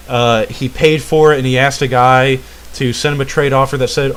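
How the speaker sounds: usual speaking voice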